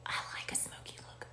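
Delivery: fast, confiding whispered aside. Real, not acted. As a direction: let the viewers in on a little secret.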